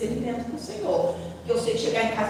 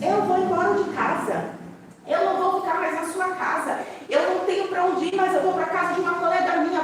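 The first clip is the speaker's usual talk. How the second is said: put-on higher, hurt defiant teen; defiant daughter; 6 semitones higher than usual. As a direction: voice the daughter announcing she leaves. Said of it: "a minha mais velha disse".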